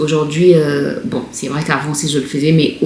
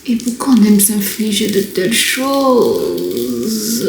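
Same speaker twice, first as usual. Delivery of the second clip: slow, higher-pitched, drawn-out moan of complaint; pained lament; 4 semitones higher, slower than usual. Real, not acted. Woman peeling off a painful face mask, pained lament. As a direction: wail it slowly, stretching the last word.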